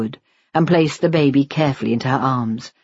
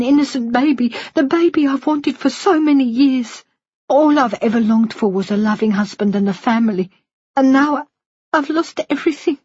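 put-on higher, tearful older woman pleading; Missus Shinwell voice; 9 semitones higher than usual. Woman confessing to the nurse, high and tearful. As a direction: voice her high and aching with guilt.

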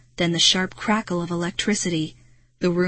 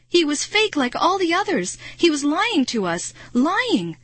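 higher, agitated outburst; distressed realization; 8 semitones higher than usual. A narrator's reading. Girl realizing the director lied, distressed. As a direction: stress each betrayal with a high, rising cry.

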